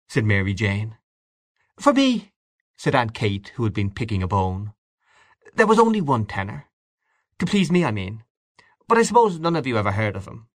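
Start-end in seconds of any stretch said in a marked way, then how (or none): 1.8-2.3 put-on very high old aunt's voice; Aunt Kate. A narrator's reading. Aunt Kate breaks in while picking a bone, high and prim. 5.5-10.5 put-on higher, quickening old aunt's voice; prim elderly aunt, a little wistful; Aunt Kate. A narrator's reading. Aunt Kate tells the table of her tenor, high and prim.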